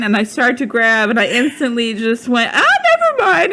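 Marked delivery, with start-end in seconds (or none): 2.5-3.5 high, loud startled backpedal; flustered cover-up, laughing. Retold or imitated.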